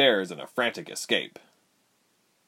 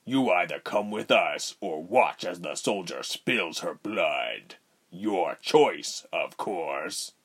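threatening; 3 semitones lower, slower than usual. A narrator's reading. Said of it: "Rubens threatened".

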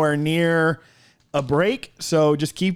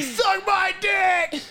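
shouting; much slower than usual. Retold or imitated. Imitating friend's crude phone reply.

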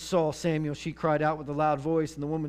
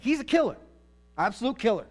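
commanders' warning; 6 semitones higher, 4 dB louder, faster than usual. Acted. Philistine commanders warning their king.

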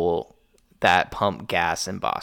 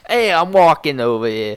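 a hint of a brash Boston accent; mock street yell, Boston style; Boston tough guy; 5 semitones higher, 5 dB louder than usual. Friend doing a Boston guy to tease his friend, light Boston accent. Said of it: "Boston New Yorker impression".

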